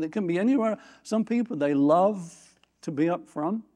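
ordinary delivery

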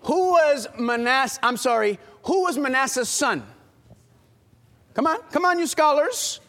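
ordinary delivery

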